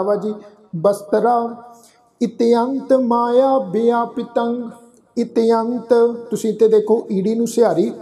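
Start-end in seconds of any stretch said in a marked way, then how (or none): 2.2-5.9 drawn-out, syllable by syllable scripture reading; slow recitation. Shown as a demonstration. Teacher reciting the hymn slowly for the congregation. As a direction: lay each syllable down slowly and reverently. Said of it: "ਇਸ ਦਾ ਉਚਾਰਨ ਹੋਏਗਾ ਜੀ".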